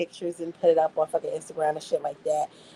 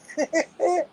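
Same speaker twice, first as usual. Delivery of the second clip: high laugh; 9 semitones higher than usual. Real, not acted. Laughing at chat.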